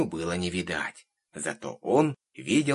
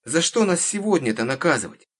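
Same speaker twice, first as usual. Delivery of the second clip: high, loud indignant question; insistent little girl; 8 semitones higher, 5 dB louder than usual. A narrator's reading. Girl questioning the witch's punishment, insistent. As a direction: keep pleading in a child's voice. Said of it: "не отступала девочка".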